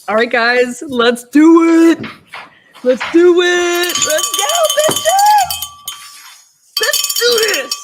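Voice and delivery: high, loud hype chant; hyped up. Real, not acted. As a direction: pump the crowd up, slow and big. Hyping up for trial start.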